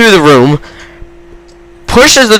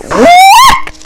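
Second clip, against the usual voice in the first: very high squeaked shock. Retold or imitated. Onlooker hearing friend swear at mom, high squeal of shock. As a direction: let it leap up in mock disbelief. Acted shock at what he heard.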